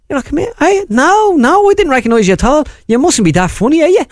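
high-pitched, dismissive Dublin delivery man; comic incredulous north Dublin voice; classic Dublin accent. Acted. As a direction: take him down a peg in high Dublin. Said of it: "that was classic Dublin".

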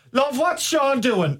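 high, slow and soft mammy calling her husband; Irish mammy. Pretend. Mum voiced in imagined scene.

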